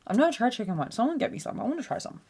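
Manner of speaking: ordinary talk, nothing marked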